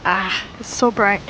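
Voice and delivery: usual speaking voice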